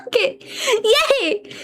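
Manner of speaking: high laughing yay. Real, not acted. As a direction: giggle through it. Laughing at mistake.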